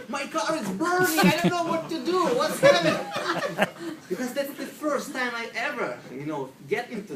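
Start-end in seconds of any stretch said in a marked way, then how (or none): 0.1-3.7 frantic, high, loud cry for help; crazy panicked Russian. Retold or imitated. Newcomer bursting in on relaxed golf club patrons, panicked. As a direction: burst in yelling in hammed-up panic. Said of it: "the crazy Russian guy comes in and says".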